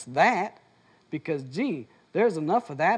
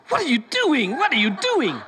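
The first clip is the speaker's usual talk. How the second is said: high, louder startled protest through laughter; startled and laughing; 7 semitones higher, 4 dB louder than usual. Retold or imitated. Tickled person protesting, high and laughing. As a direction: squeal it out while being tickled. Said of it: "The person was like".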